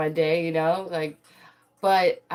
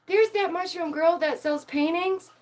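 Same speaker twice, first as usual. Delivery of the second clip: excited fans; 13 semitones higher, 4 dB louder than usual. Retold or imitated. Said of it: "And they go".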